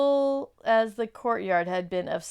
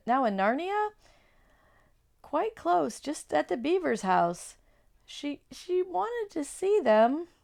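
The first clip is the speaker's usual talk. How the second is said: trembling; 7 semitones higher than usual. Acted. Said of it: "trembling so that he could hardly speak".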